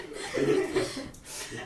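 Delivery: real breathy burst of laughter. Real, not acted. Laughs after high energy bit.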